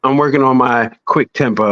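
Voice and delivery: normal talking voice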